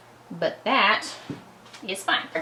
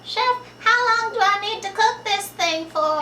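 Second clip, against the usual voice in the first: high, pleading student voice, much higher; imitating eager student; 12 semitones higher than usual. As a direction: mimic a nervous culinary student asking the chef. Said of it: "you would ask the chef constantly".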